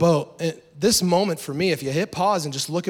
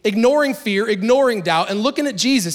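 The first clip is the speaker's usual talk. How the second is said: building preaching; 6 semitones higher than usual. Acted. Preacher urging his church to step out in faith, rising. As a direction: lift the crowd toward faith.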